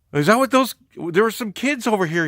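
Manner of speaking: high, loud mock alibi; hammed-up innocent bystander; feigned innocence. Man invents excuse about kids.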